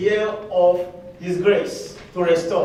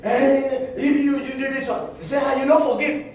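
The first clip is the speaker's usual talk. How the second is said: higher, scolding wife voice; wife scolding husband who wants quick forgiveness; mock angry wife; 5 semitones higher than usual. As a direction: nag him like an unforgiving wife. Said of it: "your wife is still saying".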